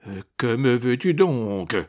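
restrained irritated growl; contained irritation. A narrator's reading. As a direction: demand impatiently, holding anger back. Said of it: "gronda le pape avec une irritation contenue". (grondement d'irritation contenue)

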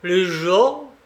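understated wild outburst, thrown back as a question; savage outburst. A narrator's reading. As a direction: cut in with a touch of savagery. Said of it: "il m'interrompit avec un éclat sauvage".

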